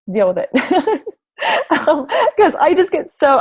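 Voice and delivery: laughing through the words; embarrassed laugh. Real, not acted. Coach apologising to her audience for her excitement, laughing. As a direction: laugh off getting emotional. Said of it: "Sorry, I get really excited".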